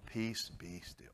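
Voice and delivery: soft, slow, gentle command; slow calm. Retold or imitated. God speaking peace to the church, soft and slow. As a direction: breathe peace over them. God's words to everyone.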